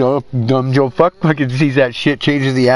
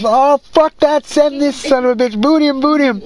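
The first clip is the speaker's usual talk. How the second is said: much higher, louder, slowed mock yell; mock outraged friend; 13 semitones higher, 4 dB louder than usual. Friend wrecked in first turn demanding a kick, high mock yell. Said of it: "friend reacts to being wrecked".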